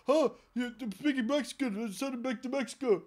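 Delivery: high-pitched dopey mock officer; hammed-up clueless official voice; ignorant agent. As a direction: blurt it out high, in a put-on alarm. Acts out ignorant agent reacting.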